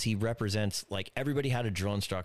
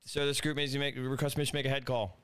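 higher shouted recruit request; barked sir yes sir; 5 semitones higher than usual. Retold or imitated. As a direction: bark the request at attention.

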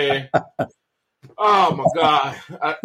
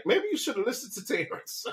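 wife's aside; 7 semitones higher, 11 dB quieter than usual. Retold or imitated. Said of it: "his wife was like".

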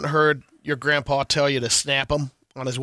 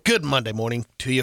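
ordinary talk, nothing marked

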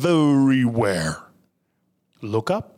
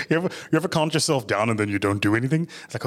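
usual speaking voice

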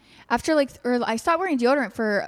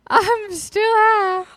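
high, slow, spaced-out voice; stoned drawl; 9 semitones higher, 8 dB louder, much slower than usual. Retold or imitated. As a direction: drag it out like you're still high. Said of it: "I woke up this morning and I was like".

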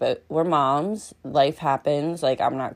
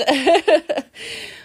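bright laugh; 11 semitones higher, 5 dB louder than usual. Real, not acted. Laugh after praise.

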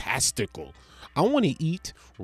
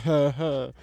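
mocking laugh; 2 semitones higher than usual. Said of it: "And I'm over here like".

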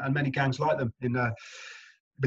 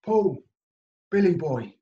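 hearty mate's hello, pitched up; cheery greeting; 4 semitones higher, 4 dB louder than usual. Retold or imitated. Castmate greeting the new actor, hearty and a bit louder. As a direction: greet him like an old pal. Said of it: "he's like".

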